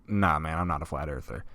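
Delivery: low, soft, offhand brush-off; imitated laid-back dismissal; casual denial. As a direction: wave it off quietly under your breath. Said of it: "A J's tone was saying".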